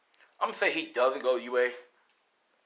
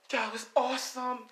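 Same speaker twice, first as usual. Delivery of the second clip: excited; 12 semitones higher than usual. Acted. Dick thrilled after a fight.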